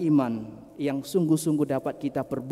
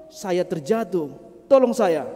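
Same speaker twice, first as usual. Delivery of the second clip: pleading; 5 semitones higher, 6 dB louder than usual. Acted. Trapped man answers.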